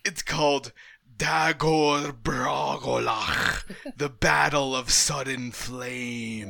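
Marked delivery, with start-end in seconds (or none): 1.2-6.5 slow, savoured title with a laugh; grand announcement. Real, not acted. Proudly naming a favorite battle, slow and grand. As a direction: draw out the epic name, half laughing.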